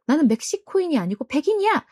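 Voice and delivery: acted-out touch of indignation; mock indignant retort. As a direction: retell it as an offended wife bristling. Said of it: "닉의 아내가 발끈합니다".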